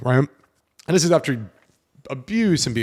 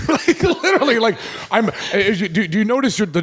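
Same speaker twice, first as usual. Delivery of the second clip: laughing through his words, pitched way up; 7 semitones higher than usual. Real, not acted. Retelling nerd party chat to friends, laughing high. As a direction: giggle as you explain.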